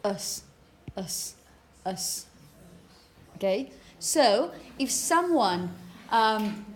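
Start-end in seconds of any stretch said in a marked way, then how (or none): 0.0-2.2 "us" as a short, weak, low syllable with schwa; reduced "us", vowel swallowed to a schwa; schwa suffix. Shown as a demonstration. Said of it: "In pronunciation, you only have".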